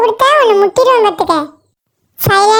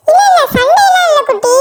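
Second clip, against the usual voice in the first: higher-pitched pleading excuse; nervous denial; 6 semitones higher than usual. Acted. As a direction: placate the angry mother hastily. Denies fight to angry mother. (பயந்து கெஞ்சி சமாளிப்பு)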